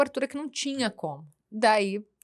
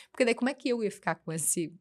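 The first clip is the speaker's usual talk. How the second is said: ordinary delivery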